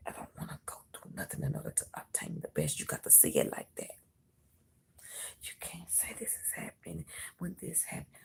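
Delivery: hushed whisper. Real, not acted. Whispers the reading.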